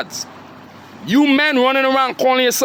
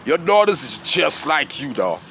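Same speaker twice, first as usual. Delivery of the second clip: imitating his mom; 6 semitones lower than usual. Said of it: "Even my own mommy say".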